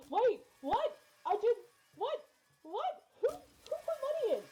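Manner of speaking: high, loud stunned what's; shocked disbelief. Real, not acted. Streamer surprised by donation.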